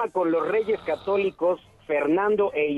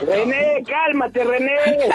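calling out; 5 semitones higher, 5 dB louder, slower than usual. Real, not acted. Telling René to calm down.

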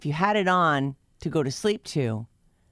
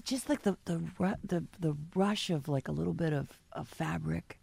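hushed; 3 semitones lower, 8 dB quieter than usual. Shown as a demonstration. Demoing quiet ASMR sounds.

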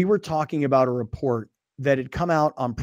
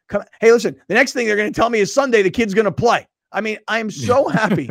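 excited; 6 semitones higher, 6 dB louder, faster than usual. Real, not acted. Joyful build about the player.

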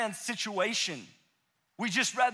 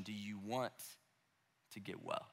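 much softer and lower, unhurried and gentle; tender quiet question; 11 semitones lower, 15 dB quieter than usual. Retold or imitated. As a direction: ask it softly, eye to eye. Jesus looks him in the eyes.

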